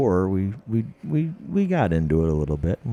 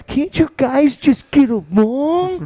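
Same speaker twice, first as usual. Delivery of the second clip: much higher, louder, slow plea; whiny pleading Coy; 5 dB louder than usual. Retold or imitated. Host imitating Coy begging two dogs to get along, high and whiny. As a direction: whine at the dogs to make peace. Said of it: "He's like".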